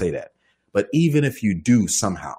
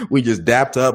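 ordinary delivery

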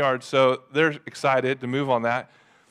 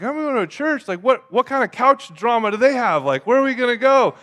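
higher-pitched, alarmed questioning; exaggerated dread, pitched up; mock terrified; 6 semitones higher, 3 dB louder than usual. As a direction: replay your worry as comic alarm. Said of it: "I was, like, terrified".

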